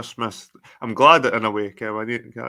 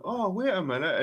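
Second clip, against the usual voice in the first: mock tourist voice; 8 semitones higher, 4 dB quieter than usual. Mimicking tourist stopping gently.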